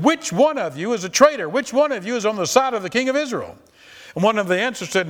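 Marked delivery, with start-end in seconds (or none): 0.0-3.6 angry king. Acted. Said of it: "asked them".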